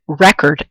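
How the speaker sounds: "record" stressed REC-ord, as the noun; stress on first syllable. Shown as a demonstration. Teacher reading an example about buying, "record" stressed on REC. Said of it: "I'm putting stress here".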